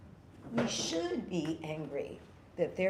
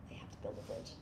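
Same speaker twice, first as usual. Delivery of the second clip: whispered repeat; 14 dB quieter, much faster than usual. Real, not acted. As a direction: whisper the line again, much softer. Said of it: "So they have to build a bridge".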